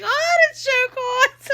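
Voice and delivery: put-on very high, loud, drawn-out accent; put-on Edith Bunker accent. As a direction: squawk it slowly in a hammed-up accent. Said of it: "I just said it that way".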